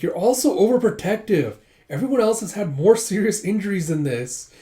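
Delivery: high, loud, exasperated outburst; heated protest. Acted. Yun yelling at the friends, loud and exasperated. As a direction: shout it at the overprotective friends.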